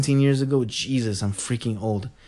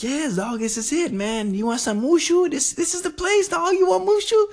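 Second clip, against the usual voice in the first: high voice climbing to a squeal; hammed-up hype at a doubting friend; excited insisting. Convincing his buddy this is the place, hammed-up excitement.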